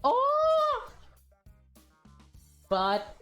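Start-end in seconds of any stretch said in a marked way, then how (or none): 0.0-0.9 very high drawn-out "oh"; excited high-pitched cooing; high squeal. Real, not acted. Reader reacts to possible crush.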